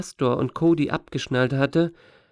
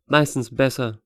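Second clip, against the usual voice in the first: plain reading voice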